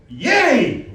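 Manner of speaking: high, loud burst of joy; effusive cheer. Shown as a demonstration. Coach urging student to be effusive, loud high cheer. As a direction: shout it out like a cheerleader. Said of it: "Really be effusive".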